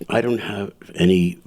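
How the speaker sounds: ordinary talk, nothing marked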